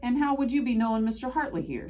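high, loud, teasing inquiry; playful woman's questioning voice; inquisitive stout woman. A narrator's reading. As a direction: pipe up high and nosy. Nosy stout woman questions the boy.

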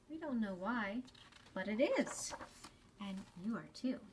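ordinary talk

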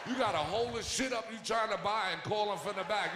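normal talking voice

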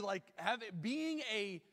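ordinary talk

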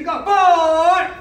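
loud, drawn-out number; drill count. Real, not acted. Coach calls count for the strike, loud shout. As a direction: project the count across the class.

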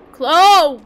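loud, high call of a name. Pretend. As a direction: holler the name out. Calls out to someone in game.

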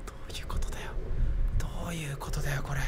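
息だけの小声でぼやく; 見つからないよう息で囁く; ささやき. Real, not acted. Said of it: "声を抑えなきゃいけない". (声を殺してささやく)

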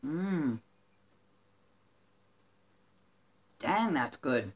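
talking while chewing food; muffled by chewing snack; mouth full, chewing. Real, not acted. Seen 0: Food put in mouth at -12; pursed, chewing mouth through speech.

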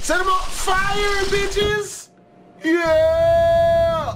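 high, slow excited yell; hyped battle cry. Real, not acted. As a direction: shout it out as you launch the attack. Hyped attack call in game.

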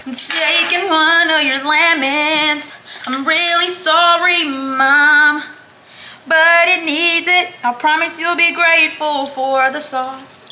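high, loud, slow sweet-talking plea; exaggerated sorry-not-sorry whine; mock pleading apology. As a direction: beg Mom's forgiveness over a lemon. Apologizes to absent mom.

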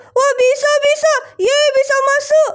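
high-pitched delighted squeal of success; excited cheer. Pretend. Rescuer finally gets into the locked room, cheering. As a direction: celebrate getting in, voice lifted high. Delighted the door has opened.

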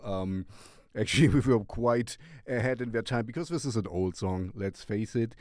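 ordinary delivery

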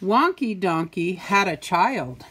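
usual speaking voice

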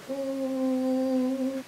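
"Hmm" as one long, steady N hum; letter sound. Shown as a demonstration. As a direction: hold the N sound softly and evenly. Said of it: "And N says".

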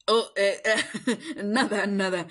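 embarrassed chuckle covering a slip; flustered laugh. Acted. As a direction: laugh it off, caught out. Caught saying something, laughs it off.

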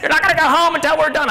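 high, loud, furious band director; yelling band director. Retold or imitated. Band director yelling at marching kids late at night, furious shout. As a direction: shout them down like a drill-sergeant band director. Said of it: "the band director's going".

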